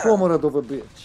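put-on Russian accent. Player joking Kevin line in Russian accent. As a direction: ham up the Russian comrade. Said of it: "Russian accent would be really cool".

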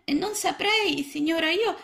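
put-on very high, small child-frog voice; a tiny lost frog, unsure; little frog. A narrator's reading. Lost little frog answering Mrs Balzelli, high and small.

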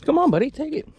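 high coaxing pet voice; high-pitched dog coaxing. Real, not acted. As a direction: coax the dog gently up high. Coaxing the dog to take something.

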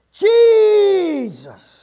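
loud, high, drawn-out cry for help; long pleading holler that falls away at the end; desperate shout. Acted. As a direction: shout after someone far ahead, holding the vowel. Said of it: "She shouted".

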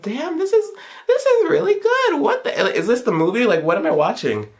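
a fan's giddy high squeal at the graphics; high-pitched awe. Retold or imitated. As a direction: gush at the screen like a starstruck fan. Awed by graphics.

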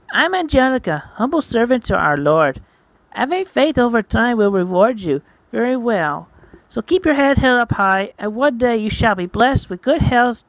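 put-on high, sing-song devout girl character; pious young Angelica. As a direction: proclaim faith in a high, lilting voice.

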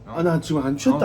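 put-on belligerent drunk. 취했으면서 안 취했다고 우김.

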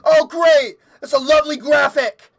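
high, loud, panting after a scare; rattled, high-pitched sarcasm through the shock; shaken by fright. Real, not acted. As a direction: still reeling from a scream. Shaken right after jump scare.

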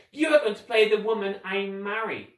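lofty villainous role-play; scheming villain. Acted. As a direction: declare it like a self-important villain.

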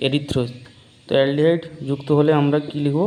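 ordinary talk, nothing marked

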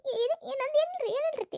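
high, choked voice hiding tears; tearful denial. Acted. Daughter-in-law hides her tears from mother-in-law, high and choked. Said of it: "ಧ್ವನಿ ಒಂಥರ ಆಗೈತೆ ಅಲ್ಲಿಂದ".

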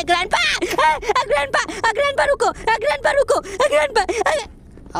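fleeing gamer screams at chasing grandpa; panicked screams. Real, not acted. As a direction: wail and beg grandpa to stop.

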